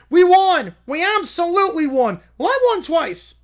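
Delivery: very loud, high victory shout; triumphant cheer. Acted. Plaintiffs cheering the judge's ruling, loud and triumphant. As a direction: celebrate winning.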